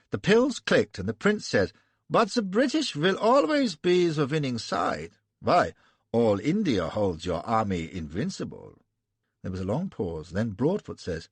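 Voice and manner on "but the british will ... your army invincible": high, louder German princeling; lightly lisping flattery. Acted. German prince flattering the British, light German lisp. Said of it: "affected lisp".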